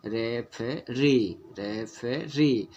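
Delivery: रेफेरी very slowly, syllable by syllable, weight on री; drawn-out रेफेरी, easy first two beats, firm री; stress on last syllable. Shown as a demonstration. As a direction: lean on the final री of रेफेरी. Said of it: "last वाला को री जोर दे के पढ़ रहे हैं".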